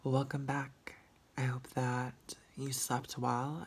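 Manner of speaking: breathy sleepy whisper; hushed just-woke-up rasp; whispery morning voice. Real, not acted. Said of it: "this is my morning voice".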